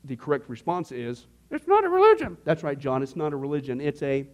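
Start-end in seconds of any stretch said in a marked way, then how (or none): 1.5-2.4 very high, loud mock-eager exclamation; hammed-up stock answer. Giving the correct response for laughs, hammed-up high voice. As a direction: pipe it up high like an overeager pupil.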